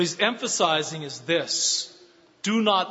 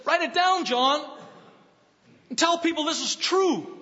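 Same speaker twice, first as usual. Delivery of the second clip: much higher, ringing command; climactic cry; 10 semitones higher than usual. Acted. Preacher quoting the angel's command to John, ringing high. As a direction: proclaim it like the angel himself.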